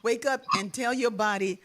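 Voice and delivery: ordinary talk, nothing marked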